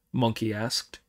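ordinary delivery, nothing marked

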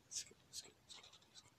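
urgent whisper to himself. Real, not acted. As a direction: breathe the words, no voice. Urging himself on mid-match.

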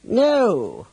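high, falling villain voice; villain at the door. A narrator's reading. Voice from the door blocking the escape, villainous. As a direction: draw out the refusal in a high voice. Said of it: "said a voice from the door".

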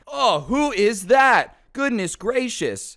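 high, slow, hammed-up gasp of surprise; mock fooled bystander. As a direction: play the clueless onlooker, way up high and drawn out. Mocking classmates fooled by disguise.

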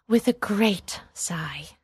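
ordinary delivery

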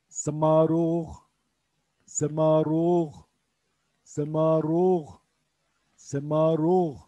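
Semaruh drawn out slowly, syllable by syllable; slow Urdu pronunciation. Shown as a demonstration. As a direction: spell out Semaruh slowly for learners. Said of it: "Mantar derken de semaruh diyoruz". (Semaruh kelimesini yavaşça heceleyerek söyle)

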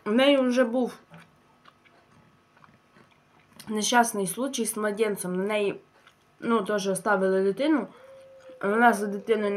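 eating between words; talking while chewing. Real, not acted. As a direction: mouth partly full of food. Seen 0: Tears meat, chews, puts pieces to mouth throughout the words.

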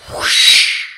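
loud rushing whoosh of air; imitated whoosh. Craft hurtling towards the ocean, imitated whoosh. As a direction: make the noise of something hurtling past.

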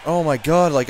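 normal talking voice

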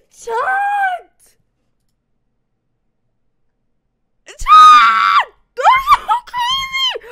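shrieking high and loud at chat; real shrieking excitement; excited shout. Real, not acted. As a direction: scream it out, overwhelmed with joy. Overwhelmed after serenade.

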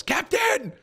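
high-pitched mock yell; imitated yell. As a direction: call out like the guy in the clip. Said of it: "he just yells".

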